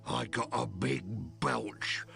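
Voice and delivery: breathy whisper, slow. Acted. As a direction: whisper it slowly. Game character whispering.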